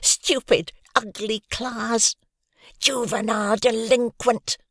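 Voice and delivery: high, slow whiny taunt; whining. Acted. Pickle whining at Hoggy from two chairs away, slow. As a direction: keep whimpering insults. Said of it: "kept whining".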